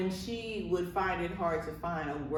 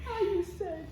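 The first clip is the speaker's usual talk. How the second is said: high, put-on African accent; lightly voiced African accent; imitating African accent; 14 semitones higher than usual. Imitating the director's catchphrase.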